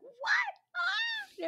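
a very high, loud squeal of disbelief; shrieking roommates. Retold or imitated. Roommates on the car phone, squealing very high and loud. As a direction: shriek it like friends hearing huge news. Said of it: "they were like".

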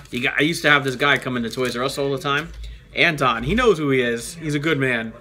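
ordinary delivery

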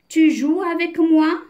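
high, lifted "tu joues avec moi?"; question intonation. A narrator's reading. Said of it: "Il lui pose la question".

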